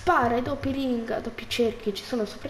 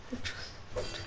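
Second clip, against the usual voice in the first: whispered complaint; 14 dB quieter than usual. Real, not acted. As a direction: whisper "non è giusto" under your breath. Lamento sommesso. (lamento sussurrato)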